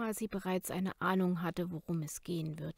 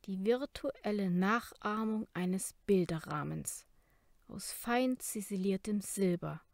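usual speaking voice

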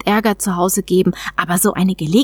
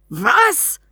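surprised cry; 5 semitones higher than usual. Acted. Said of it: "rief Orl".

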